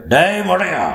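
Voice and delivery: high, loud angry yell; furious shout. A narrator's reading. Enraged lord yells an insult before striking. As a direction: bellow the insult at him. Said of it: "என்று கூறி".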